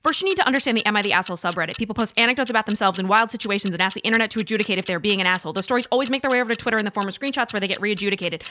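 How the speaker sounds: fast, rushed recap; rapid-fire. Real, not acted. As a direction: race through it. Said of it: "do it as quickly as humanly possible".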